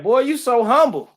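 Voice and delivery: high, loud praising voice; mock admiring people. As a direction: gush like a fan after church. Imitating people praising him.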